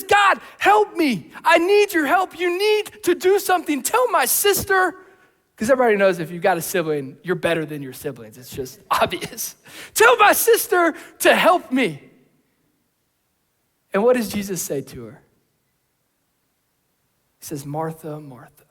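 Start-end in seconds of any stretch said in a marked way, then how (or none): 0.0-5.0 shouted, higher, urgent plea; frantic demand. Acted. Martha demanding Jesus' help. 9.9-12.1 shouted, higher, urgent plea; frantic demand. Acted. Martha's demand repeated. 17.7-18.6 soft, lower, tender call of her name; calm her down with the name; gentle rebuke. Acted. Jesus gently answering.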